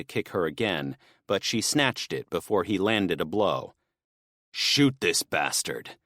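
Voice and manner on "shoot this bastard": slow, low and worn out; exhausted, dragging each word; tired voice. Acted. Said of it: "Smith said in a tired voice".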